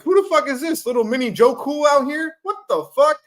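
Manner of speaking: high, loud disbelieving quote; stunned outburst at the new quarterback; incredulous. Retold or imitated. Incredulous quote about Purdy.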